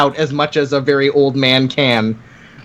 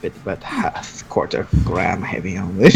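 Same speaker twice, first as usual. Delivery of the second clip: low, much softer, near unvoiced appraisal; lowered, hushed judging mumble; muttering old smith; 9 semitones lower, 7 dB quieter than usual. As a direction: mutter it like a fussy craftsman weighing a blade. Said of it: "he goes".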